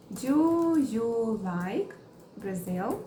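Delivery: very slow, chunked; slow teaching read; slow model question. Shown as a demonstration. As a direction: drag it out for learners. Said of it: "Vamos criar mais exemplos".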